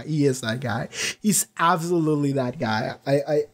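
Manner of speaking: normal talking voice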